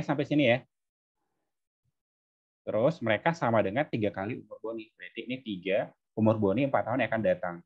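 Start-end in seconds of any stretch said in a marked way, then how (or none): none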